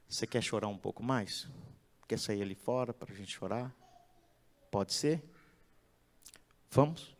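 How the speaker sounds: put-on calm the upset child tenderly; soothing parent. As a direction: parent gently leading Miguel outside.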